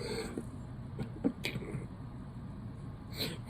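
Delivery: long, breathless laughing fit; real wheezy laugh; helpless laughter. Real, not acted. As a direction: crack up until the voice gives out. Cracks up over the actor.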